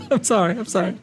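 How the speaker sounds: high apology through laughter; real giggly apology; laughing apology. Real, not acted. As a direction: say sorry while still laughing. Laughing while apologizing to X-Man.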